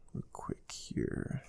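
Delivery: whispered under the breath, slowing down; whispered aside. Real, not acted. Instructor pulling up files for recruits, whispered to himself. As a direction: mutter it to yourself while searching the files. Muttering aside while searching computer.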